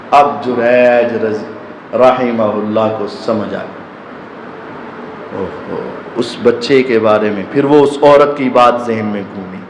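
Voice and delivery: usual speaking voice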